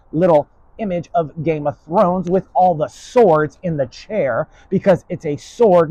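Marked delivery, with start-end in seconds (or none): none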